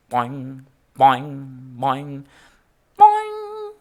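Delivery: springy boing sounds, the last leaping way up; bouncing boings. A narrator's reading. Narrator bouncing George on the bed, springy boing sounds.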